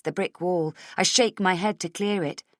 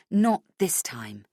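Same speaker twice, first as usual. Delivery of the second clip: ordinary delivery, nothing marked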